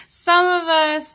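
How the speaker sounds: loud, high, playful emphasis; joking outburst. Real, not acted. Reader jokingly counting herself among Capricorns, loud and amused. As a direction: burst out with it after a laugh. Jokes about including herself, laughing.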